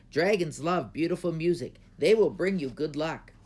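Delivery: a hint of an excited old man; old man tempting the princess, eager. A narrator's reading. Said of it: "interrupted the old man".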